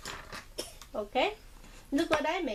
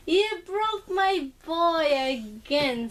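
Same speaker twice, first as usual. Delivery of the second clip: higher, slow pretend-hurt reproach; playful mock complaint; 6 semitones higher, 3 dB louder, slower than usual. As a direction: scold him playfully, fake upset. Mom mock complains to son.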